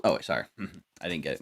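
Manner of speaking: ordinary talk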